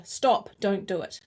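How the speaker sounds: usual speaking voice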